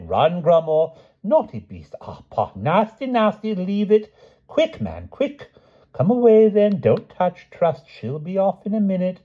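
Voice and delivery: high, frantic scolding of a beast; flustered old king. A narrator's reading. The king to beast and knights, frantic high scolding.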